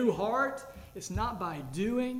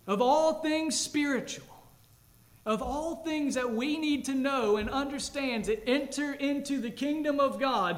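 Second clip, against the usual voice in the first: rising, louder preaching voice; 6 semitones higher, 5 dB louder than usual. Acted. Pastor proclaiming to the church, rising preaching. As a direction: proclaim it to the congregation. Preacher building to full force.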